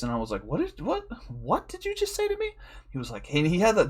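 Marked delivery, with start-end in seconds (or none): 0.4-2.5 very high, soft, friendly uncle voice; gentle, sky-high voice for talking to a friend's kid; cool uncle voice. Acted. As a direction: put on a high-pitched cool uncle act. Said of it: "I went cool uncle voice".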